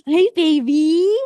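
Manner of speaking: loud, high, drawn-out cooing; dog-greeting coo. Retold or imitated. Greeting a dog on the street, gushing coo. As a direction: gush at a passing dog. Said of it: "I'm like".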